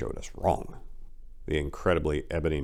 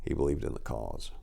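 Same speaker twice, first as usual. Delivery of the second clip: softer, lower, solemn tribute to the fallen; quiet solemn; 5 semitones lower, 6 dB quieter than usual. A narrator's reading. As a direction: lay the cause down gently, with respect.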